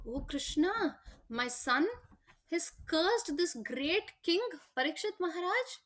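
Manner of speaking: retold dismayed exclamation. Father appealing to Krishna over his son's curse, acted dismay. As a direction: imitate a father's shocked lament, drawing it out.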